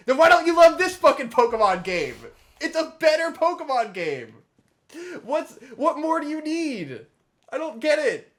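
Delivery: demanding it of all the fans at once; exasperated rant. Real, not acted. Ranting to a friend about Pokemon fans, exasperated near-shouting. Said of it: "That's, that's my rant".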